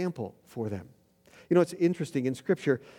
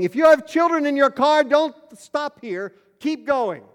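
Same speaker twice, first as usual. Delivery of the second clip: loud, high mock warning to drivers; calling out; 9 semitones higher, 7 dB louder than usual. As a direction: holler it as if waving cars away. Imagined man calling out to cars.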